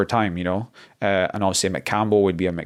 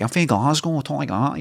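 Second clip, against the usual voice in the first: high-pitched Glasgow voice, a light imitation; Glaswegian impression; 6 semitones higher than usual. Guest imitating how Glaswegians sound, high pitched. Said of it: "they talk really high pitched".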